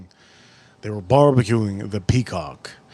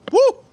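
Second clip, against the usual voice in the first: much higher, louder woo; 7 dB louder than usual. Real, not acted. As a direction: yelp at the heat. Spice reaction.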